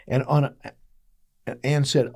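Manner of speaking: usual speaking voice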